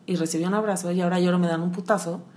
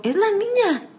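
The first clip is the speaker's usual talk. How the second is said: put-on higher, childlike realization; a touch of little girl; 6 semitones higher than usual. Recalling hearing herself cry over an ex. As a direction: say it like a small girl discovering herself. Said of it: "y yo".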